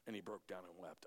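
quietly telling how Peter wept; hushed grief. A narrator's reading. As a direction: let it collapse almost to nothing.

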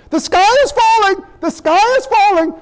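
high, loud frantic alarm; hammed-up panicked shouting, pitched high; panicky Chicken Little. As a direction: cry doom like Chicken Little. Mocking fearmongering media.

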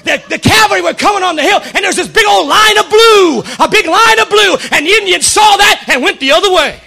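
loud, high, excited storytelling shout; full-force preaching. Real, not acted. Preacher telling of the cavalry's arrival, shouted excitedly. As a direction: build the rescue at the top of your voice.

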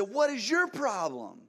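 high, slow angry yell thrown right back. Retold or imitated. Speeding driver shouting back at the pastor, slow high angry yell. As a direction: snap the question back, loud and slow. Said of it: "He said".

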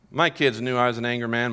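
ordinary talk

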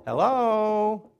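a hint of God calling out; voice of God greeting. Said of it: "and God's saying".

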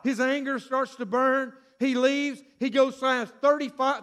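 usual speaking voice

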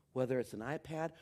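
ordinary talk

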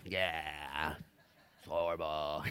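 hammed-up dreary voice; mock gloomy. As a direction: sound like you were baptized in pickle juice. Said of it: "baptized in pickle juice".

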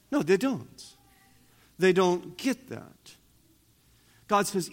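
normal talking voice

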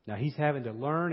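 ordinary talk, nothing marked

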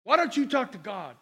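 ordinary talk

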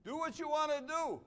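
mock carefree shout. Pastor mimicking the young's careless attitude, mock shout. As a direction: toss it off like a reckless youth.

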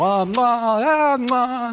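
high, drawn-out cheek-kiss sounds; loud mock Italian cheek kisses; Italian relatives kissing. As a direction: play a gushing Italian aunt kissing both cheeks. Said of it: "My Italian relatives".

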